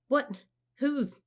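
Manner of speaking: high startled sputter. A narrator's reading. Annie startled by the giant eyes, sputtering high. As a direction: stumble over the words in shock. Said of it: "sputtered Annie".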